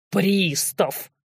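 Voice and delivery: drawn-out contemptuous repeat; scornful snort. A narrator's reading. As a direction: throw the title back with scorn. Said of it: "фыркнул разбойник".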